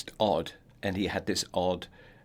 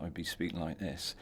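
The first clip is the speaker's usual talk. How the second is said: softer, a hint of broad rural Oxford accent; 3 semitones lower, 9 dB quieter than usual. Shown as a demonstration. Showing the interviewer how he'd talk in his Oxford accent, softer. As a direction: drop into your childhood Oxford accent, understated. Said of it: "if I was speaking to you in my Oxford accent".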